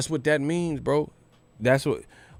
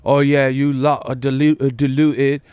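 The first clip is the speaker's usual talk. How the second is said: mock British accent. Vlogger mimicking British commenters in a mock British accent. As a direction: ham up a cockney insult. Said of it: "That's some U K shit".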